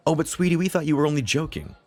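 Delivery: high-pitched mom voice; coaxing mother. A narrator's reading. Mother coaxes irritated son.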